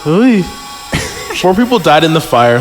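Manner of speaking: ordinary talk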